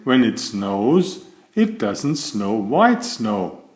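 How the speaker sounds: plain reading voice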